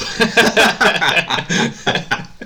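loud, high-pitched belly laugh; real burst of laughter. Real, not acted. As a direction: crack up loudly and keep going. Laughs at the sacking headline.

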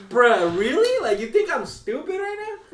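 mock outrage, like you're being played; incredulous. Retelling how he answered a lowball trade offer, incredulous. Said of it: "and I'm like".

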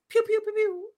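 high playful pew pew noises; pew pew sound effect. Retold or imitated. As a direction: fire off cartoon laser sounds. Mimicking Tiger's shots.

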